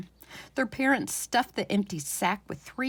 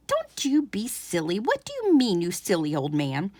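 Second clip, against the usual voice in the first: put-on scolding old wife. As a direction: tell the old man off.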